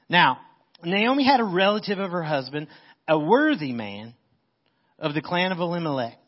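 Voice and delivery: preacher reading the Bible aloud; scripture reading. Acted. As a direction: read the passage out with weight.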